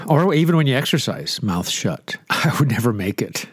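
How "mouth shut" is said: normal talking voice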